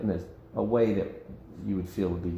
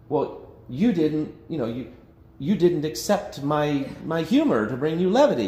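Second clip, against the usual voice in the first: mock complaint; 7 semitones higher, 5 dB louder than usual. Joke teller's imagined complaint.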